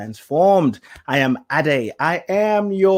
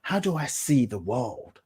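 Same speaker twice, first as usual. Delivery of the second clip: normal talking voice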